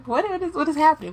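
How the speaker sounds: high, incredulous what is happening; retold disbelief. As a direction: act baffled and thrown off. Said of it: "Pre-order?".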